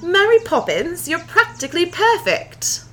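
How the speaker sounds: high, hammy British accent. Shown as a demonstration. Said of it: "Exaggerate the R's and the P's".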